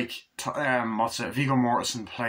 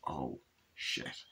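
much softer and lower awed gasp; a viewer stunned by the violence, soft and low; stunned hush; 10 semitones lower, 13 dB quieter than usual. Retold or imitated. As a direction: barely breathe it out in shock.

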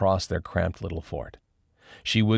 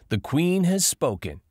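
mocking; 11 semitones higher than usual. A narrator's reading. Dion mocks Cadence.

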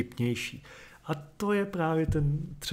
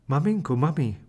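mild acted cry, a bit louder; 6 dB louder than usual. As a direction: call out to the fallen mother in alarm. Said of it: "s výkřikem".

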